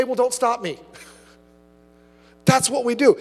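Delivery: normal talking voice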